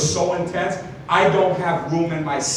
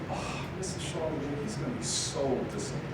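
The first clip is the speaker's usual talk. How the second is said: put-on very soft, sorrowful old lady; hushed, gently disappointed older lady; sweet grandmotherly receptionist; 2 semitones lower, 13 dB quieter than usual. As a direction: sigh it like a let-down grandma. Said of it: "kinda like a grandmother, just really nice".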